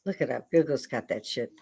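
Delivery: ordinary talk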